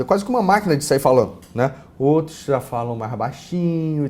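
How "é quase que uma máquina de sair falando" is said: rapid-fire like a talking machine; falando rápido. Shown as a demonstration. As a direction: rattle it off fast. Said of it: "Tem gente que fala rápido". (fala acelerada como uma máquina)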